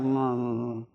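low mumbled grumble under the breath; grumbling hum. Retold or imitated. Child told to do chores, grumbling "mm, mm" under breath. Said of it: "they're like".

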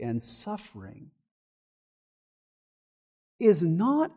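hushed reassuring preacher; tender and quiet. Real, not acted. Tender closing application.

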